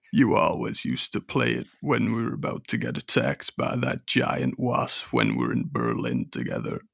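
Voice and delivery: very low, soft, gravelly rocker; deep [name] voice. Acted.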